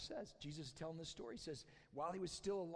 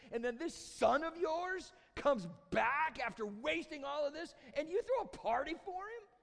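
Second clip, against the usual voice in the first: angry complaint; 4 semitones higher, 4 dB louder than usual. Acted. Older brother protests to his dad about the party, mounting anger. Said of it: "So angry".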